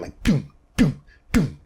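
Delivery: short dead "doom" like a kick drum. Shown as a demonstration. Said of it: "kind of a dead sound".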